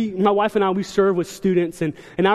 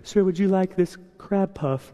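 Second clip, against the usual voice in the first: polite waiter; 5 dB quieter, slower than usual. Pretend. Offering a crab puff, playing server.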